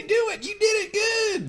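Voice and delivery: very high, shouted cheer. Retold or imitated. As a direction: cheer him on at top pitch. Voicing a cheering wife.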